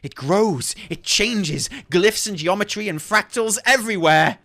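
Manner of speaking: theatrical reading with a rising climax; dramatic rambling scholar. Acted. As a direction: start composed, work up to manic wonder. Reads lore in character.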